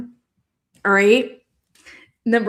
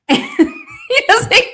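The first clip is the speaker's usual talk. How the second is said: laughing. Real, not acted. Laughing mid-sentence.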